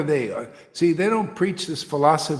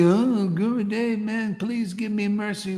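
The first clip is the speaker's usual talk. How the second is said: higher, whiny begging disciple; mock disciple; 6 semitones higher than usual. Disciple mocked begging the guru for mercy, whiny. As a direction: plead like a lost follower.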